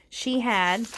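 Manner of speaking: ordinary delivery, nothing marked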